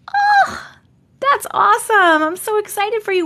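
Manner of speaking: high, bright burst of joy; excited for viewer. Real, not acted. Reacting to a viewer's vacation news, excited gush. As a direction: gush with delight for her.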